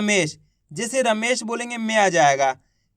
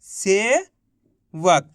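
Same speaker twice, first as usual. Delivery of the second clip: slow drawn-out word; 3 semitones lower, much slower than usual. Shown as a demonstration. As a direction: stretch सेवक out very slowly. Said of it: "तो मिलके बन गया". (सेवक बहुत धीरे खींचकर)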